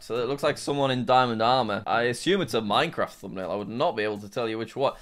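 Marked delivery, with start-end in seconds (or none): none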